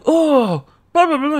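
high-pitched mock chatter; imitating an excited greeter. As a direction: put on a high, gushing voice. Imitating Andrew approaching her.

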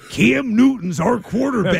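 put-on wrestler catchphrase delivery; Randy Savage. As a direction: imitate a hyped wrestling promo. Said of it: "Randy Macho Man Savage impersonator on Cameo".

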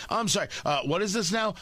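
ordinary delivery

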